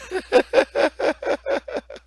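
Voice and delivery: a burst of high-pitched laughter. Real, not acted. Preacher laughing at the retraction, high real laugh.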